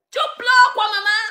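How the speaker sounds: high mocking question; mock pity. Real, not acted. Mock pity at crying rival. (fausse pitié, voix aiguë)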